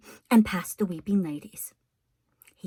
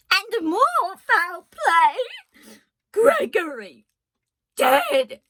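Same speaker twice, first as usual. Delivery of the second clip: loud, slow, high cry of alarm; shouting cook; 11 semitones higher, 6 dB louder, much slower than usual. A narrator's reading. Cook shouting to the kitchen about Gregory, loud high cry. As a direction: bellow the bad news, voice climbing. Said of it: "shouted the cook".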